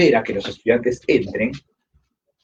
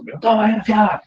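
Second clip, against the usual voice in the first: mock furious; 11 semitones higher than usual. Axe blow in the retold murder.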